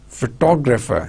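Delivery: "photographer" with the weight on TOG; pho-TOG-rapher; second-syllable stress. Shown as a demonstration. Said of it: "Stress syllables, two".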